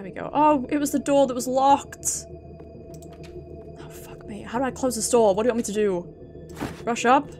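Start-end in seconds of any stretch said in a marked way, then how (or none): none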